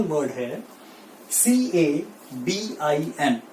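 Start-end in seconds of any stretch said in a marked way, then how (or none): 1.3-3.4 सीएबीआईएन spelled letter by letter, slowly; slow spelling. Shown as a demonstration. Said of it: "क्या बोलते हैं?". (धीमी spelling)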